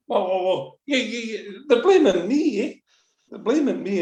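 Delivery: high, rattled pleading; agitated protest. Retold or imitated. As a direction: pitch up, flustered. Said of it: "he's going".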